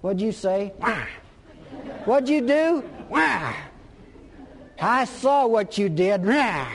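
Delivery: hammed-up barking accuser; mock a harsh judge shouting Wah; mock stick-wielding Jesus. Imagined Jesus scolding sinners with a stick, hammed-up barking. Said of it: "comes along with a big stick and saying".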